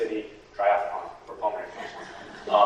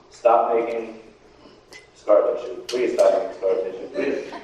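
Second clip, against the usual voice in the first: mock pleading; 3 semitones lower than usual. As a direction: plead with it, half joking. Pleading with his own lungs.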